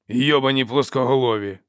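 loud angry outburst; thundered curse. A narrator's reading. Dow complains of flatheads over his meat, thundering. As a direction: boom out the curse in disgust. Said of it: "гримнув Доу".

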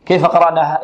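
ordinary talk, nothing marked

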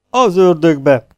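loud booming curse from the brute; thundering curse. A narrator's reading. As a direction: roar it like a giant. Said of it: "mennydörögte a dúvad". (mennydörögve, hangosan káromkodva)

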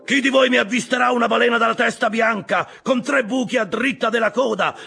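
very high, loud rallying cry; full-voiced shout. A narrator's reading. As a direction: proclaim the reward at full voice to the crew. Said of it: "a voce spiegata gridò". (gridato a voce spiegata, acutissimo)

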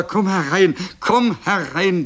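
high, loud welcoming call; elated mother's call, drawn out at the end; eager calling. A narrator's reading. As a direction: call your child inside, overjoyed. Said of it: "rief die Mutter".

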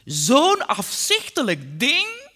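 high, loud, drawn-out derision; gleefully scornful father; roaring mockery. A narrator's reading. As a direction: jeer it slowly through mirth. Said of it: "Hij schaterde".

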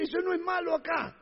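high-pitched mock protest; imitated excuse-maker. Preacher mimics people abroad excusing swearing, high mock protest. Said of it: "hay países donde dice".

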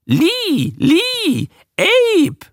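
shrieky high creature yelps; animal barking. A narrator's reading. Beasts echoing the couple's names at them, high barking. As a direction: bark each name separately, very high. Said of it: "bellte es vor ihm".

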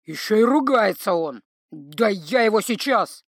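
lightly voiced angry troll; troll fumes at the insult. Troll threatening the bard.

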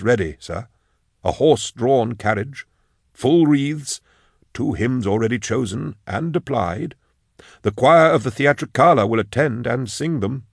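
formal lilting undertaker, reading down the list; solemn obliging undertaker. Acted. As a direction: recite the arrangements with deferential care. Slender undertaker lists funeral arrangements.

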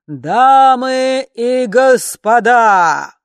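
booming slow announcer, high and loud; thunderous voice. Acted. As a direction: boom it out like a ringmaster. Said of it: "громовой голос". (громовой голос конферансье)

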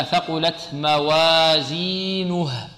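slow drawn-out recitation of "ثقلت موازينه"; Quranic recitation. Shown as a demonstration. Teacher recites slowly for students. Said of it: "هكذا".

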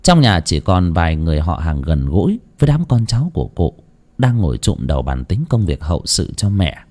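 ordinary delivery

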